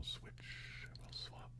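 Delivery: slow hushed ASMR whisper. Shown as a demonstration. ASMR host whispering to stream viewers, slow and hushed. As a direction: breathe the words close to the mic. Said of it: "I will have a little whisper".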